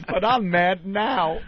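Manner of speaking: slow, dopey Homer voice, drawn out; lightly done Homer line, drawn out; Homer impression. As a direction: Homer Simpson again, slow and dumb. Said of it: "He goes".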